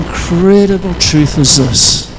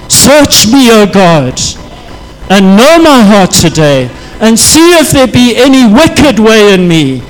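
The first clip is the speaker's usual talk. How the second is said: lifted, louder praying voice, rising to God; fervent prayer; 2 semitones higher, 3 dB louder than usual. Acted. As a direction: pray it out with fervour. Prays aloud in sermon.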